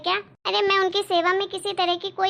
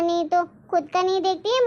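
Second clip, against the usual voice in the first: ordinary talk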